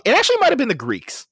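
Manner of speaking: high, loud, rushed self-correction; sudden realization. Real, not acted. Host catching own mistake about the Romans, sudden loud realization. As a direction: blurt it out as it dawns on you.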